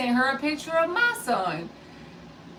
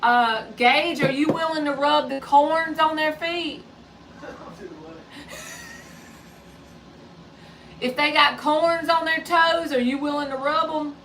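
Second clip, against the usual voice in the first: raised voice called to the next room; calling out; 5 semitones higher, 3 dB louder than usual. Real, not acted. Host calls to Gage in another room, raised and carrying. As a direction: call it out so he hears from afar.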